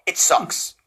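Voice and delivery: low whispered disgust; whispered verdict. Real, not acted. Repeats verdict two ways.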